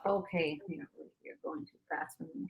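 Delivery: very soft, half-whispered aside; hushed, barely voiced complaint; under one's breath. Real, not acted. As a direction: say it almost in a whisper, falling behind. Falling behind noting a number.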